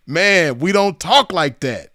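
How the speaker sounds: normal talking voice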